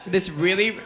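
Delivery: ordinary delivery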